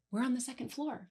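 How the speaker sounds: hushed imitation of a scared friend; retold hyperventilating. Scared friend telling mom through hyperventilation, mildly imitated. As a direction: softly mimic a panicked child's revelation. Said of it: "through hyperventilating".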